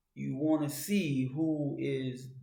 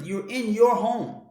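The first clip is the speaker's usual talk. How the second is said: normal talking voice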